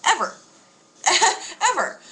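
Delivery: high Ever, laugh, Ever again; amused, high, insistent; laughing emphasis. Real, not acted. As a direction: punch the word through a laugh. Punchline with laugh.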